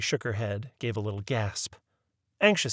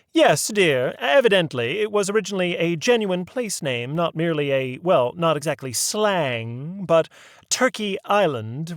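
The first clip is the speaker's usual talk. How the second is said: higher, fussy genteel lady; a proper old lady leading the inquiry; prim lady voice; 5 semitones higher, 7 dB louder than usual. A narrator's reading. Mary Mabel explains the place name.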